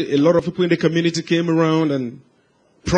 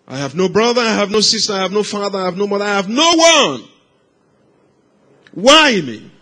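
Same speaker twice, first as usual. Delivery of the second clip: anguished shouting; 2 semitones higher than usual. Retold or imitated. Lonely boy pleading with God, building to a shout. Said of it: "kept shouting".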